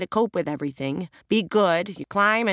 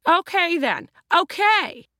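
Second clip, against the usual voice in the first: much higher, louder irritated snap; snapping; 12 semitones higher, 5 dB louder, slower than usual. Acted. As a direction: bite the words off, fed up. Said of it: "the real estate agent finally snapped".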